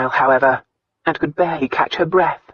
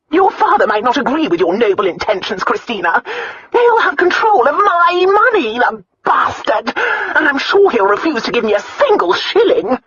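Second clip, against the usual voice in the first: high, agitated Countess, loud on the complaint; bitter aunt, the Countess; 11 semitones higher, 6 dB louder than usual. A narrator's reading. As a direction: rail as a resentful, self-pitying aunt.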